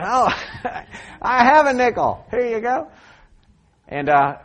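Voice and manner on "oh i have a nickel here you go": higher-pitched reply with a laugh; amused delight. Retold or imitated. Preacher re-enacts offering a stranger a nickel, amused delight. Acts out his happy surprised answer.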